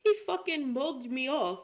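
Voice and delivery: high, loud mock English accent; indignant put-on English accent. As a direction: imitate English reality-show slang. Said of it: "then they be saying".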